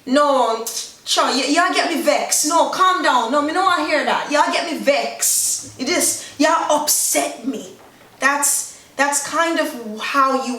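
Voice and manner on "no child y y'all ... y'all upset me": annoyed. Acted. Fed-up speaker refusing to hear more, annoyed. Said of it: "you just get so annoyed".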